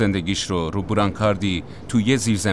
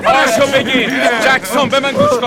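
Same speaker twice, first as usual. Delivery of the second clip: much higher, louder shouted reassurance; urgent calming shout; 6 dB louder than usual. Acted. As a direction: get through to a panicking man. Medic shouting to calm wounded man.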